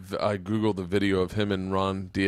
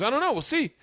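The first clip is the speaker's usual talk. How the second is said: high, mocking shrug of a reply; imitating a host brushing off an apology; lightly voiced high sarcastic put-down; cold dismissal. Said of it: "And Ron goes".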